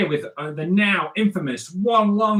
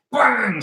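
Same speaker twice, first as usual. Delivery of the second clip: punch sound effect; 7 semitones higher, 5 dB louder than usual. Acted.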